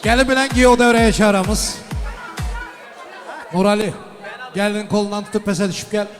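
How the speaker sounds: booming wedding announcer. Acted. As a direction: announce it to the whole hall. Host calls couple to dance.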